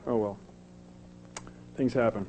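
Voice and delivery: ordinary talk, nothing marked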